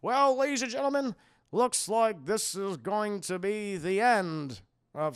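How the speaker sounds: hammed-up news commentator; station reporter. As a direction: proclaim it like a TV reporter on air. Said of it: "the reporter at the station's like".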